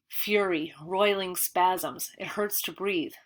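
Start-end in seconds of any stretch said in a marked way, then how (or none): none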